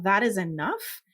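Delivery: ordinary talk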